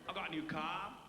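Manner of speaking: streetwise South London patter; chatty, boastful London street character; Delbert Wilkins, cocky Brixton lad. Acted. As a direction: play a swaggering Brixton wide boy.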